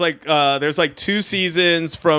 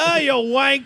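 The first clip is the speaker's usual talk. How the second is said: higher put-on British voice; mock British jeer; 9 semitones higher, faster than usual. Jeering at the stuck burglar, mock British. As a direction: tease in a mock Brit accent. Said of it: "that accent is still so fun to me".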